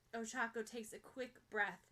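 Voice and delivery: ordinary delivery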